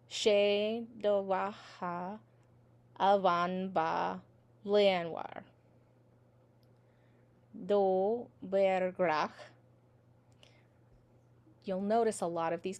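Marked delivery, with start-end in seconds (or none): 0.1-9.3 slow, firm syllable-by-syllable Irish; nice and slow. Shown as a demonstration. Teacher saying it with each other, nice and slow. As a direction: stretch each syllable out evenly for repeating. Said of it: "let's say it nice and slow".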